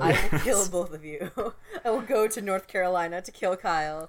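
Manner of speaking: loud mock threat breaking into laughter. Teased guest to both co-hosts, loud mock threat. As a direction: threaten your friends loudly, half laughing.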